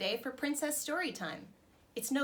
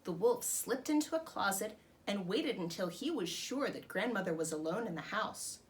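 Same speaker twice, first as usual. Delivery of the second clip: usual speaking voice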